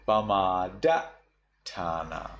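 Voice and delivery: Pamadatthana stopped dead after DA; slowly, with a silent gap before thana; Pamadatthana, first T totally unspoken; cut-off sound. Shown as a demonstration. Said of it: "it's just totally cut off".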